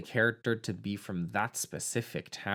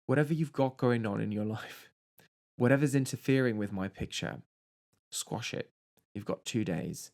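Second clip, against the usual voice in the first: put-on English accent; 2 semitones lower, 4 dB quieter than usual. Producer giving Maxine an ultimatum about the picture, English accent. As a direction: softly imitate an English film producer, accent throughout. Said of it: "all of this in an English accent".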